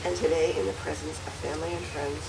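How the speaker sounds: softly fed vow line; quiet prompting. Real, not acted. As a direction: murmur the words for repeating. Officiant feeds vow lines.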